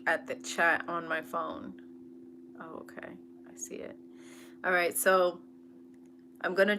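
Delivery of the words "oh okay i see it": quick whispered aside. Real, not acted. Aside spotting the chat on phone.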